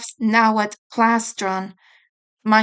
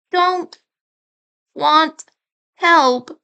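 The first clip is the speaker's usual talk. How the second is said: higher, choked little refusal; sulky refusal; 6 semitones higher, 4 dB louder, much slower than usual. Acted. Younger brother shutting Raph out, sulky and hurt. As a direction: push him away, small and hurt. Mikey refusing help, upset.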